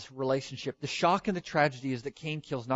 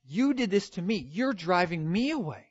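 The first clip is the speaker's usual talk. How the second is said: high-pitched mock grievance; accusing; 6 semitones higher, 3 dB louder than usual. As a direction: act out aggrieved blame, voice raised. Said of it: "he brings accusation against God".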